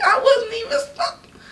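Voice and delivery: high crying whine on wasn't; mock sobbing. Protesting the smoking fee to viewers, mock sobbing.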